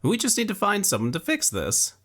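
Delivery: cling to hope for your friend; young Luque, desperate. A narrator's reading. Young man begging his dying friend, high and urgent.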